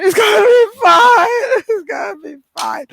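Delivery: loud, high tearful outburst that then subsides; mock breakdown. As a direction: pretend to crack while reassuring yourself. Acts breakdown while reassuring herself.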